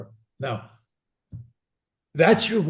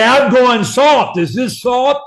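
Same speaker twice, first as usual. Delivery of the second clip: much higher and louder, slow; mock loud student; 9 semitones higher, 12 dB louder, slower than usual. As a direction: exaggerate how loud he sounds. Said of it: "That's the way you sound to me".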